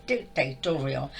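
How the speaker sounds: "dictatorial" said low and slow, each syllable weighed; stressed word dictatorial. Shown as a demonstration. Naming the master's tone, "dictatorial" slow and low. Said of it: "that's the word".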